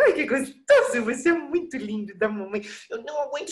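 put-on cooing baby talk with laughs. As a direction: coo and blow raspberries at a baby. Mother cooing to her baby.

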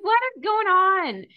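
high, loud, slow bewildered cry; overwhelmed disbelief. Retold or imitated. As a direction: drag it out in disbelief. Overwhelmed by the move.

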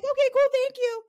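high, loud cheerful thanks; mock chipper acceptance. Retelling accepting gifts, high loud chipper thanks. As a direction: squeal it like a delighted kid. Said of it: "And I'm like".

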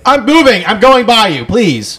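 loud, high, over-clear warning; hammed-up anxious heads-up to a stranger; mock announcement. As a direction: call it out to clear your path. Acting out announcing himself passing a woman.

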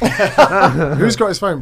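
slow, loud, laughing repeat; laughing, drawn out. Retold or imitated. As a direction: stretch it out through a laugh. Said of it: "I'm like".

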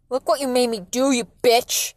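loud, furious blame; forceful shouted insult; yelling. A narrator's reading. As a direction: yell it as the enraged father. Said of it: "Dad yelled".

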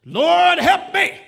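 loud pleading cry to the Lord; desperate cry. Acted. Woman begging Jesus for help, loud desperate cry. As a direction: call out for help with everything you have.

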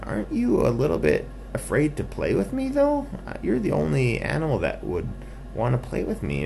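high-pitched little skunk; wondering skunk. Acted. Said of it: "the skunk said".

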